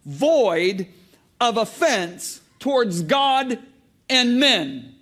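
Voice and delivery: loud, high, slow scripture read; full-force preacher voice; preaching. Acted. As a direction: drive each word home to the church.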